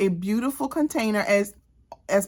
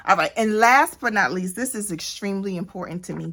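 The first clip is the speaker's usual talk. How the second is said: normal talking voice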